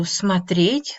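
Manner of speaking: смотреть with the stress on the final -еть. Shown as a demonstration. Teacher showing learners the infinitive, смотреть stressed on its ending. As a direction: lean slowly on the last syllable of смотреть. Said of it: "the stress falls on a ye".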